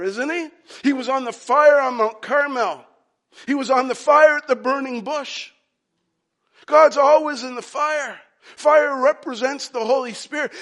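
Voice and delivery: high, loud, near-shouted insistence; excited certainty. Acted. Preacher argues that God must be in the fire, excited. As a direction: build the case at full volume.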